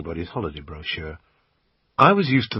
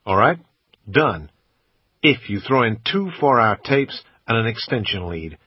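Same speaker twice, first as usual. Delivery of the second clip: put-on a louder American accent, kept up while haggling; bad American accent; 4 dB louder than usual. Brit quizzing the shop assistant, bad American accent. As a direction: keep the clumsy American put-on going. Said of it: "in my usual bad American accent".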